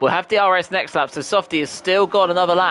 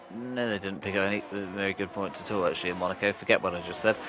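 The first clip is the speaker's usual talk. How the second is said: quiet correction; 8 semitones lower, 11 dB quieter than usual. Real, not acted. Correcting his own mistake aside.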